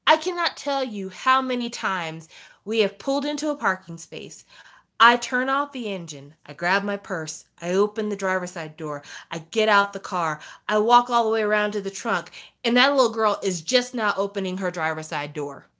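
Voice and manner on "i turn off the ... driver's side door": usual speaking voice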